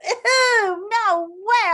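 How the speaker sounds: high, loud grossed-out recoil; squealing disgust. Pretend. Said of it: "makes you go".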